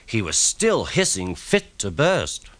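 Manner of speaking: plain reading voice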